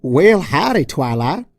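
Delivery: loud, folksy Southern drawl greeting; a cheery country-girl howdy in a Southern drawl; Applejack's Southern drawl. Acted. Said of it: "in her usual awkward drawl".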